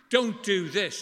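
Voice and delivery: mimicking a shouting angry God. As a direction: bark it like a stern angry God. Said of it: "an angry God who shouts at us".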